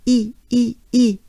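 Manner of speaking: separate, exaggerated smiling E; exaggerated vowel. Shown as a demonstration. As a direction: overdo each E as a wide ee. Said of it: "We exaggerate those vowels".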